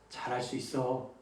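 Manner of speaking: very soft, low 잘할 수 있어. Shown as a demonstration. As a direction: drop it right down, nearly murmured. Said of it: "한 톤만 낮출 수 있기를".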